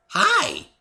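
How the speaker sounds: high, loud bright-eyed hello; perky greeting. A narrator's reading. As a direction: chirp it out like an excited pony. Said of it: "very perky".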